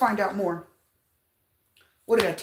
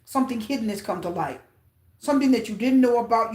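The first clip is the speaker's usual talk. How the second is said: normal talking voice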